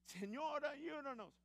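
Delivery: father crying out to God while driving, pleading high; urgent plea. Retold or imitated. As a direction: call out for help in alarm. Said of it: "para empezar a decir".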